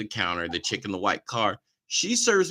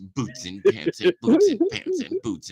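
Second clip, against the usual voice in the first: club DJ voice; 4 semitones higher than usual. Pretend. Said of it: "I get that D J voice".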